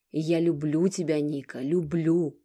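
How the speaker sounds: loud, slow declaration of love; shouting. A narrator's reading. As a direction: shout it out for all to hear. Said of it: "Хочешь, закричу об этом?".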